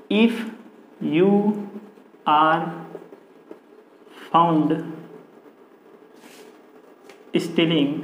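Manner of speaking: long pauses between single words; word-by-word dictation. Real, not acted. Teacher writing out the passive sentence for students, word-by-word dictation. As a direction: dictate it slowly, one word at a time.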